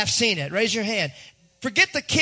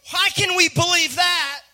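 usual speaking voice

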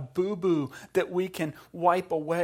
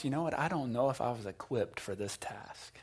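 whispered doubt; 5 semitones lower, 7 dB quieter than usual. Retold or imitated. Retold inner doubt mid-task.

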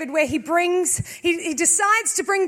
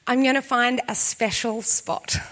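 lightly voiced inner thought; 6 semitones lower than usual. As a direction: think it aloud, low and deliberate. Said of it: "you think this".

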